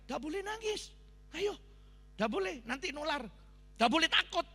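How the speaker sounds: high-pitched, insistent retold command; firm rallying order. Pastor retelling how he told crying pilgrims to stop, firm high command.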